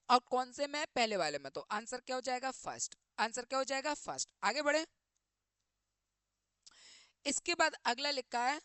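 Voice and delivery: normal talking voice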